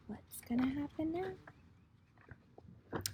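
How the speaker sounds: put-on slow whispered question to the kids; suspense whisper. Shown as a demonstration. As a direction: build suspense in a slow hush. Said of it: "Boys and girls".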